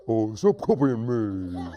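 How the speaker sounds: slow goofy mimicry; silly hunter. Silly hunter mimicking the warning, slow goofy voice. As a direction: echo it dopily, drawn out. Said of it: "He repeats everything his friend says".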